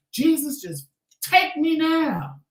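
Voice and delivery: a hammed-up dying cry to heaven; dramatic plea. Patient at dialysis begging Jesus, high slow hammed-up wail. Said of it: "I was being dramatic".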